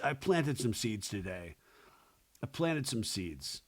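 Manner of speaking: low, soft boyfriend imitation; quiet, low mock-sly guy voice. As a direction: imitate him confiding quietly, voice dropped.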